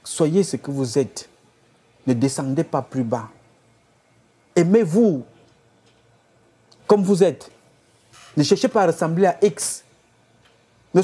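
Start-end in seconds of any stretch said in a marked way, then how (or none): none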